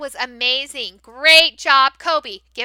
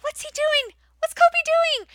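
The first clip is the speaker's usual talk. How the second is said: animated wonder; 12 semitones higher than usual. Shown as a demonstration. Teacher asking young learners about the animation, amazed. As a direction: make it a big exciting mystery. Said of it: "I will just do it with everyone".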